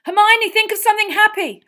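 high, full-force urgent yell. A narrator's reading. Harry urging Hermione against the Dementors, yelling. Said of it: "Harry yelled".

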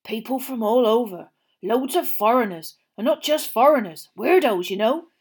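the owner grumbles to Mr Weasley about odd campers; grumbling campsite owner. A narrator's reading. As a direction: grumble about the weirdos on your field.

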